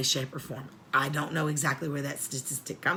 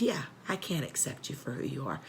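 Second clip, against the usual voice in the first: quiet retold refusal; 4 semitones lower, 9 dB quieter than usual. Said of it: "I said".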